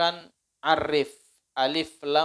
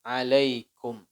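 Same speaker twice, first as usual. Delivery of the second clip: Assalamu alaikum very slow and drawn out; correct salam; much slower than usual. Shown as a demonstration. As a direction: recite the proper full salam slowly. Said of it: "Itu salamnya".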